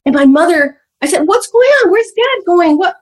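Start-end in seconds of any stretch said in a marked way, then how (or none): 1.3-3.0 put-on high, frantic child's cry; child's high startled voice, lightly acted; panicked child. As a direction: ask in alarm like a young kid. Child voiced asking about dad.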